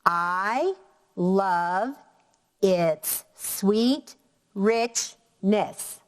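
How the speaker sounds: each syllable separate, "I... love... its... sweet... rich-ness"; tapped-out syllables. Shown as a demonstration. Teacher tapping six syllables for students, each set apart. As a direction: count it out beat by beat with clear pauses. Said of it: "I'm gonna tap out using my pointer".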